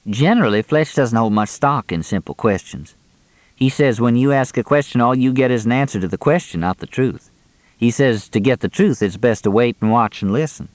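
ordinary delivery, nothing marked

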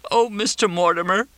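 high-pitched tearful wail; weeping. A narrator's reading. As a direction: weep his name plaintively. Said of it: "Crystal wept".

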